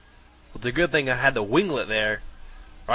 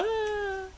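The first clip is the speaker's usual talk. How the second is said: mock falling yell; 7 dB quieter than usual. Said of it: "I'm like".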